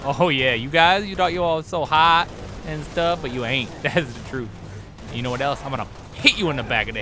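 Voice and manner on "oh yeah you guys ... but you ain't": loud, higher taunting boast; mocking the enemy team; trash talk. Real, not acted. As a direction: gloat at the enemies. Trash talking enemies.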